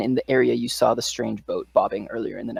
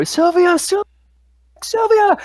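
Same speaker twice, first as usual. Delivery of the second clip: much higher, louder desperate cry for his wife; frantic scream; 4 dB louder, slower than usual. Acted. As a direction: scream her name as she flees. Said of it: "Jeremy screams".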